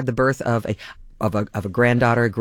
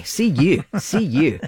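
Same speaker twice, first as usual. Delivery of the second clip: Scottish "See you, laddie" with See punched higher, a falling Glasgow lilt; Scottish accent; 5 semitones higher, slower than usual. Retold or imitated. Radio host shows off his Scottish accent after the Scottish Fantasy. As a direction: toss it off like a cheeky Glaswegian farewell. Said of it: "you come by that accent authentically".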